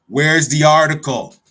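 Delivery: loud, drawn-out demand; demanding shout. Real, not acted. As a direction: shout it slowly, calling out the missing reporter. Demanding the missing article, repeated.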